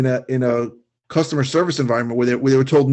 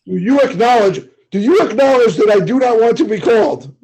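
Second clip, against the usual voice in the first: mock pushy caller; 7 semitones higher, 4 dB louder than usual. Mocking the caller's demand.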